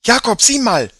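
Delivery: put-on a girl's voice jumping up in alarm; high voice. A narrator's reading. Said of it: "mit hoher Stimme".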